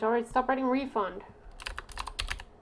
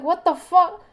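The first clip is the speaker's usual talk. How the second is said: higher, loud appalled outburst; outraged disbelief; 7 semitones higher, 8 dB louder, slower than usual. Retold or imitated. Retelling her reaction to the friend's insult, a loud appalled outburst. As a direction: explode in offended shock. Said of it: "And I was like".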